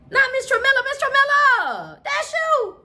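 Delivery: like you just caught auntie red-handed, squealed high; shocked disbelief. Real, not acted. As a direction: gasp out her name in outrage. Shocked at Tramilla's betrayal.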